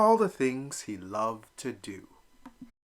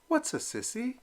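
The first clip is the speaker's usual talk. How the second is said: timid question; 13 semitones higher, 4 dB quieter than usual. Acted. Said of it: "it took all his courage".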